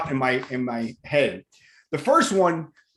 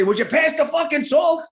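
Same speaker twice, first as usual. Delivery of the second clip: higher, shouted dinner-table demand; father's mock shout; 9 semitones higher than usual. Father at the Italian dinner table, higher shouted demand. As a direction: bark it like a Queens dad. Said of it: "My father would say".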